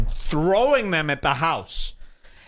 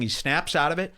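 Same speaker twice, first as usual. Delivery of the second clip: usual speaking voice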